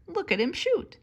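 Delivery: plain reading voice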